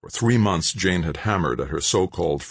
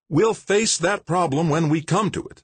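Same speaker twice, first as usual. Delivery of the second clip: higher, brusque interruption; mayor's voice; 12 semitones higher than usual. Acted. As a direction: cut him off impatiently. Mayor cuts engineer off.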